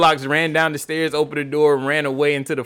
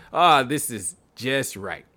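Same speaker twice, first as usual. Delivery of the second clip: satisfied sigh; slower than usual. Acted. Goldilocks finds the right chair.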